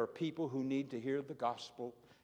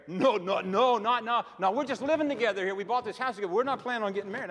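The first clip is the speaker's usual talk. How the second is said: flustered neighbor; 6 semitones higher, 7 dB louder, faster than usual. Retold or imitated. Voicing the neighbor's hasty answer.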